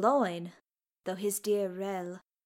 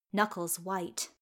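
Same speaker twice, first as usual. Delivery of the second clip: ordinary delivery, nothing marked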